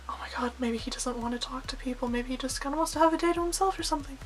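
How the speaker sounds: very soft, whispery inner voice; hushed worried thought. Retold or imitated. Fan doubting whether to approach the YouTuber, hushed and hesitant. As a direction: retell a nervous thought under the breath. Retelling her anxious private hesitation.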